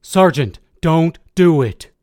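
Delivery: urgent, slow shouted warning; screamed plea. A narrator's reading. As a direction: yell to stop him, drawing out the words. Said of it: "I screamed".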